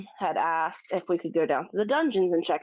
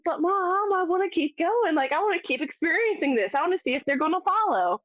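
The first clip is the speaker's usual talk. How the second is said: much higher, eager begging; excited pleading; 9 semitones higher than usual. Retold or imitated. Twelve-year-old begging mom to stay, high and thrilled. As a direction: plead to keep exploring the ghost.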